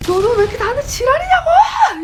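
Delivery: very high, very loud acted scream; furious screaming. Dreamer screams at the ghost tormenting them in sleep paralysis. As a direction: let the outburst rip at the top of your voice. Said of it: "크게 소리치게 됩니다".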